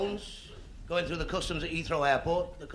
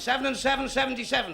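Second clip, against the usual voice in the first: higher bingo caller's call; 8 semitones higher than usual. Retold or imitated. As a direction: call the number out across the hall. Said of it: "He shouts".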